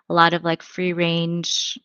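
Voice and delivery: normal talking voice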